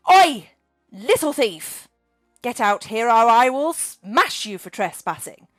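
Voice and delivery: loud, slow threat at a thief; snapping dragon. Acted. Dragon Margaret threatening an intruder, loud and slow. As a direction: bark it into the shadows. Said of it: "she snapped".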